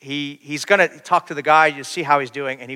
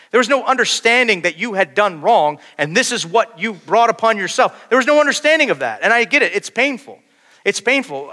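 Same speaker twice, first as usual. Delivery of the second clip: louder, higher preaching on no sin in Greek medicine; 8 semitones higher, 7 dB louder than usual. Acted. Preacher driving home his point to the congregation, louder. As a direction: build the point, pressing harder. Sermon rising to full force.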